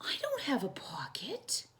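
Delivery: breathy whisper of a small bear; soft whispered aside; whispered to himself. Acted. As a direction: whisper it to yourself, a little sad. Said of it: "said Corduroy to himself".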